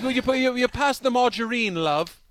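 high-pitched, put-on British accent. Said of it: "work on your British accent".

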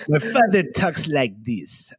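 slow heavy Persian accent impression. Son mimicking his late father, heavy Persian accent. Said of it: "I did an impersonation for you of my father".

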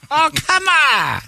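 high, slow, drawn-out protest; mock outrage. Real, not acted. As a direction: whine it out, stretched long.

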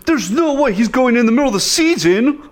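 high-pitched freaked-out fan; freaking out. Retold or imitated. Celtics fan friends freaking out over the rumor, high mock panic. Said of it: "like, freaking out".

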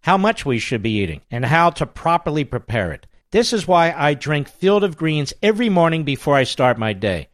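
upbeat, punchy radio ad read. Acted. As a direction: sell it like a radio host. Host reads ad for Field of Greens.

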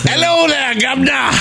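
hammed-up Cockney shout; Cockney greeting. Host greets the chat in a Cockney voice. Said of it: "speaking of Cockney and governors".

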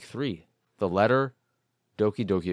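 normal talking voice

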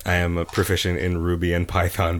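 ordinary talk

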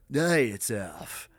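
slow, drawn-out deep-voice impression; slow impression of a deep-voiced radio guy. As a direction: do F's big deep voice, stretched slow. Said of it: "a real deep voice, kinda like this".

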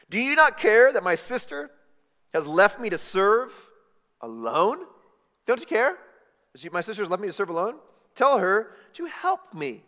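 high, loud, frustrated complaint; exasperated Martha. Retold or imitated. Martha complains loudly to Jesus, exasperated. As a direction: Martha pleading angrily with Jesus. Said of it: "Martha says to Jesus".

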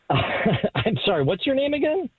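usual speaking voice

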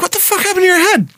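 high, fast, shocked question; a barber gasping at the wound; high startled barber voice. Retold or imitated. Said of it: "he's like".

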